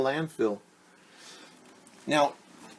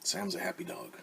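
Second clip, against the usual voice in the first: whisper; 8 semitones higher, 12 dB quieter than usual. Acted. Hushed aside after dog voice.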